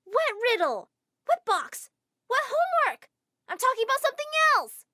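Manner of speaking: put-on exasperated girl brushing off the teacher's riddle talk; exasperated disbelief. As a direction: throw each question back at him in disbelief. Frustrated with clueless teacher.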